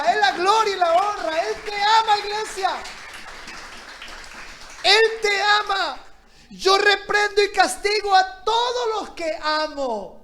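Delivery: fiery preaching. Acted. Preacher proclaims to the church that God loves them. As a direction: proclaim God's love to the church.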